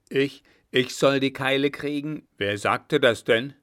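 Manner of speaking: loud, affronted questioning; indignant marshal. Acted. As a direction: demand to know with mock-gruff indignation. (laut und empört nachgefragt)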